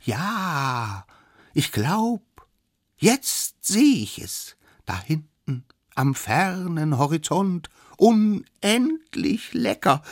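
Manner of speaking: high, slow, drawn-out sighting call; dreamy little lookout. Acted. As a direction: savour the vision from up high. Lookout reports sighting from above. (kleiner Späher, hoch und genüsslich gedehnt)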